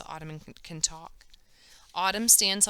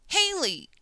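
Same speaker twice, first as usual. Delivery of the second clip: a sharp yell pitched much higher; yelled name; 12 semitones higher, 4 dB louder than usual. A narrator's reading. Haley Chun yells after Haley Ranson, sharp yell. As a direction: call her back across the street with a yell. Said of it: "Haley Chun yells".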